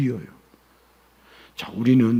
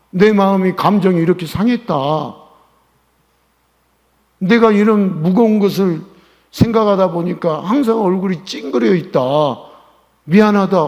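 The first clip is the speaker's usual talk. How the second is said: louder, raised pastor's apology; loud mock apology; 6 semitones higher, 8 dB louder than usual. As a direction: own up loudly to the congregation. Pastor acts his own apology. (크게 외치듯 사과)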